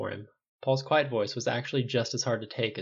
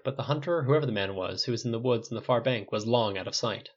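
ordinary delivery

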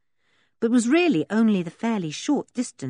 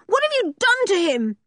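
high, frantic demand; anguished high-pitched accusation; crying out; 13 semitones higher, 4 dB louder than usual. A narrator's reading. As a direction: cry out in outrage and fear. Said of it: "she cried".

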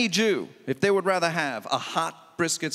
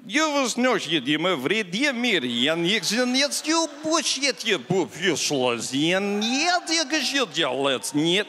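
mock Russian gibberish. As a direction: fake Russian babble, choppy and jumping in pitch. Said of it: "It's like".

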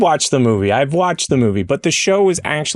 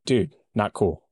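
lower, softer offended dude; 6 semitones lower, 4 dB quieter, slower than usual. Pretend. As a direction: play a hurt, low-voiced spider. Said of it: "Spider-Man right now is like".

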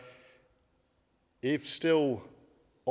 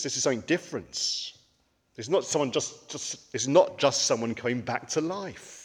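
usual speaking voice

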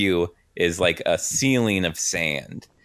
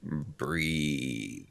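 low slow stone monotone; deep voice; 4 semitones lower, 10 dB quieter than usual. Acted. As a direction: intone it like a stone giant. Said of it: "you hear a deep voice go".

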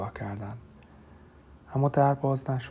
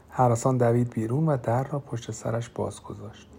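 ordinary delivery

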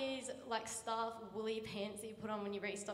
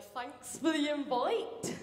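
drawn-out, louder awkward line; awkward slow joke; 3 semitones higher, 6 dB louder, much slower than usual. Retold or imitated. Girl trying to be funny with the guy, slow and awkward. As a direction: stretch out a clumsy joke.

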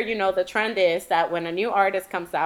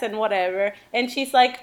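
ordinary delivery

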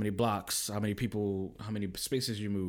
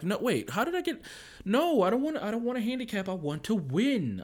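much higher, louder mock kid whine; whining child; 13 semitones higher, 4 dB louder than usual. Daughter refusing a handicap, mock whining. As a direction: wail it like a sore loser. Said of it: "and they're like".

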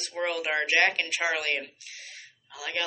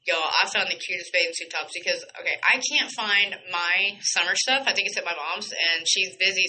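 normal talking voice

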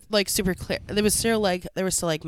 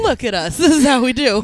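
loud, laughing boast; proud playful shout; excited; 7 semitones higher, 11 dB louder than usual. Real, not acted.

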